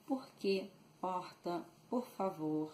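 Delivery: "Por quê, Porta" with a throaty h-like R; carioca R. Shown as a demonstration. Teacher showing own Rio R, "Porta" with h-like R. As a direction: breathe the R in "Porta" from the back, carioca style. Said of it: "we have the R carioca. That's the R I pronounce".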